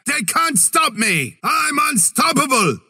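high shouted boast; high triumphant battle shout. Acted. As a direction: roar it out in triumph. Boasting mid-fight.

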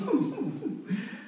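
quiet, high chuckle; soft laugh. Real, not acted. Preacher pausing for the congregation, soft high chuckle. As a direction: chuckle softly to yourself.

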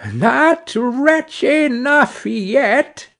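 high, slow, otherworldly bird voice; taunting sparrow. A narrator's reading. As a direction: drawl the threat like a vengeful little bird.

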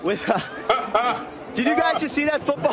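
laughing through the words; can't keep a straight face; anchor stifling giggles. Real, not acted. Anchor breaks up laughing on air.

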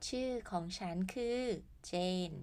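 normal talking voice

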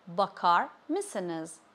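slow "bakar", pause, then "mısınız"; slowed word by word. Shown as a demonstration. Teacher modelling "bakar mısınız" for learners, slowly word by word. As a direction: separate "bakar" from "mısınız", taking each slowly.

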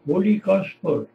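normal talking voice

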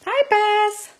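high, loud cheery hi; excited hello. Real, not acted. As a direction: call out happily to a friend. Greeting a live viewer.

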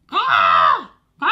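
short squawking cry; bird squawk. Acted. Stormbird squawking over the hedge. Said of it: "rozległ się skrzek".

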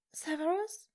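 high, sniffly questioning call; tearful hopeful sniffle; sniffling. A narrator's reading. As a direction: sniff out his name hopefully. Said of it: "schniefte sie".

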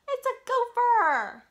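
gasp, then high, loud and slow discovery; big surprise. Pretend. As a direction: make the kids gasp at the find. Reading flap book to kids.

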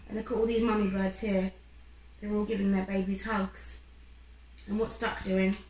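ordinary delivery

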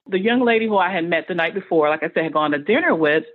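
ordinary talk

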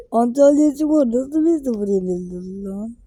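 can't believe the score; shocked gasp. Real, not acted. Gamer reading a shock result, gasping in disbelief.

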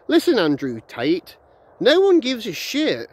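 slow, high, scornful put-down; scornful rant. Real, not acted. Ranting vlogger to Andrew Tate, slow scornful put-down. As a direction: tell him straight nobody cares.

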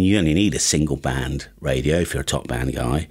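ordinary talk

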